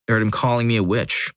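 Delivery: ordinary delivery, nothing marked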